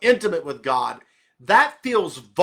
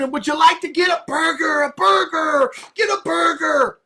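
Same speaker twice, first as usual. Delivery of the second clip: intense shouting; 8 semitones higher, 5 dB louder than usual. Shown as a demonstration. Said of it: "That, the intensity is not intimacy".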